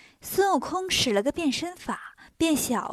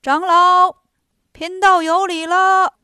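booming, slow formal greeting, much louder and higher; 6 semitones higher, 11 dB louder, slower than usual. A narrator's reading. Disguised immortal hails Tang Seng, booming slow greeting. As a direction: greet the monk in a big ringing voice, drawn out. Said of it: "对唐僧大声说".

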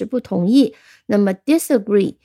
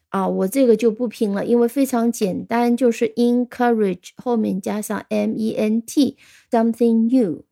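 ordinary talk

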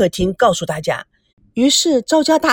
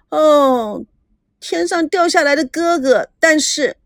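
put-on stunned girl; 6 semitones higher, slower than usual. A narrator's reading. Granddaughter bewildered.

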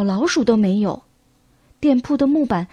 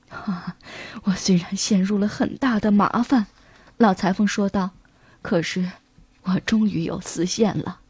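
put-on lower, soft tired old man; weak old tailor; 5 semitones lower, 4 dB quieter than usual. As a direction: speak like a frail old tailor.

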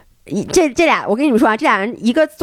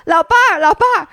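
calling out; 8 semitones higher, 3 dB louder, faster than usual. Retold or imitated. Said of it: "我说".

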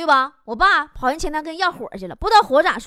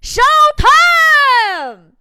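high, loud hawker's shout; a sudden, high, loud cry across the plaza; aunt's yell; 10 semitones higher, 7 dB louder than usual. Retold or imitated. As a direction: belt it out like a market aunt calling to the square. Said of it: "大吼一声".

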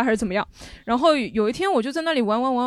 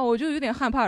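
ordinary delivery

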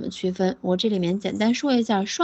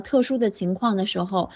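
ordinary talk

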